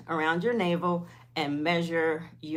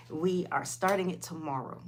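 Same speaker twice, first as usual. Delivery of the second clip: ordinary delivery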